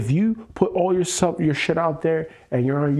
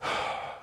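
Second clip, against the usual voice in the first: soft exasperated sigh; heavy sigh; 11 dB quieter than usual. Real, not acted. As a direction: let out a heavy breath. Venting at partner over secret debt.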